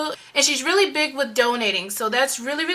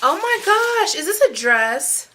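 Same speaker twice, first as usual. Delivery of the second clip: real high-pitched gasp of amazement; delighted surprise; 4 semitones higher than usual. Real, not acted. Vlogger unwrapping a mystery package, real delighted surprise. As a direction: burst out high in happy surprise. Unboxing a gift, spots a dress.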